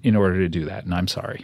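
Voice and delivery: low, soft, contrite; subdued apology. Real, not acted. Host apologizing to the guest he contradicted, low and quiet. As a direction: back down gently, voice dropped low.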